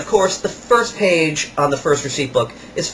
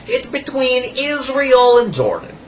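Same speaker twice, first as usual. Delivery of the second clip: higher, slow put-on salesman voice; imitating the salesman; 10 semitones higher, slower than usual. Kiosk salesman rubbing salt on a customer's hands, imitated higher and slower. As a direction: ham up the pushy kiosk seller. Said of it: "He says".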